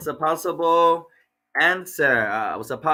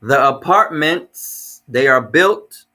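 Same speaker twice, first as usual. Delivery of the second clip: 1 slow reading; 8 dB louder, slower than usual. Shown as a demonstration.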